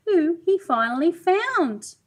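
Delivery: ordinary delivery, nothing marked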